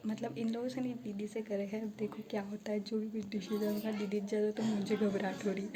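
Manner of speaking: speaking softly so the people nearby don't hear; hushed voice. Real, not acted. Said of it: "अभी मैं धीरे धीरे बोल रही हूँ". (दबी आवाज़ में, धीरे-धीरे)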